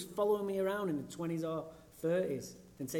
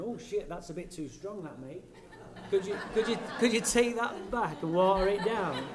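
mock polite plea; 3 semitones higher, 4 dB louder than usual. Mock polite request to bartender.

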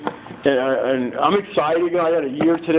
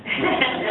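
short laugh; 9 semitones higher than usual. Real, not acted. Laughs at child support money.

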